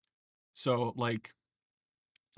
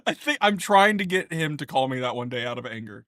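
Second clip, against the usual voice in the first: ordinary talk, nothing marked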